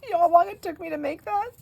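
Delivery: very high, loud wail; hammed-up child's indignant complaint; outraged child, imitated. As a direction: squeal it like a kid whose work is ruined.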